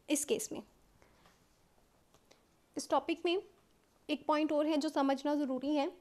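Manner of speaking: normal talking voice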